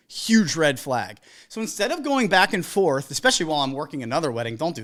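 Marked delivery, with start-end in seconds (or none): 0.1-1.2 slow emphatic warning. Real, not acted. Warning about a red flag.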